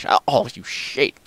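usual speaking voice

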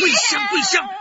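high sobbing shout of her name; desperate crying call. Acted. Husband calling his wife's name in tearful desperation. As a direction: cry her name out, begging.

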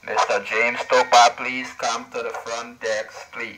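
slow PA announcement over radio; flat echoey pa page. Pretend. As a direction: page him like a front desk. Paging over walkie-talkie.

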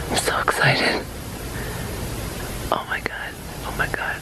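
real hushed, awestruck whisper; thrilled, fully whispered; excited whisper. Real, not acted. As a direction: whisper it so the animals won't be startled. Films raccoons nearby, keeping hushed.

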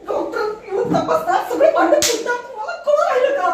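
rising nervous scolding, pitch climbing; panicky scolding. Acted. Panicked wife scolding husband.

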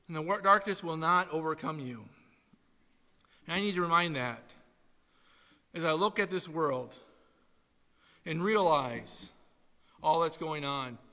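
ordinary talk

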